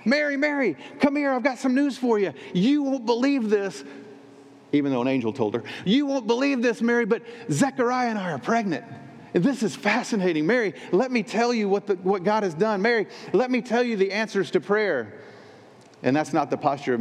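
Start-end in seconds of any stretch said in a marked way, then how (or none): 0.0-3.8 high, gushing cousin bursting with news; imitating excited Elizabeth. Elizabeth greeting Mary at the door, giddy excitement. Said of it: "If you were in Elizabeth's situation, how might you respond?". 5.8-15.1 high, eager gushing about the news; mock excited Elizabeth. Elizabeth bragging to Mary, hammed-up excitement. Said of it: "how might you respond?".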